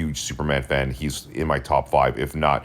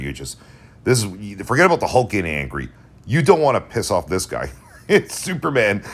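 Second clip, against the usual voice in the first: usual speaking voice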